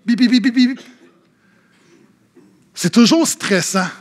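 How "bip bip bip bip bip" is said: rapid, even imitated beeping; anti-theft alarm beeps. Leaving the store, detector goes off, alarm beeps. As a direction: mimic a store detector going off. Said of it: "le détecteur antivol".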